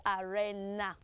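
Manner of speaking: "arena" as "a-REN-na", short e; wrong pronunciation. Shown as a demonstration. Teacher correcting a common mistake, wrong pronunciation. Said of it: "That word is not pronounced".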